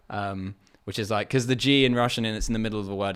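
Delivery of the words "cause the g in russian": ordinary talk, nothing marked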